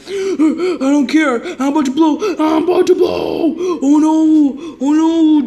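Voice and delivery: loud, strained rising panic; a character about to blow up, half laughing; about to explode. Acted.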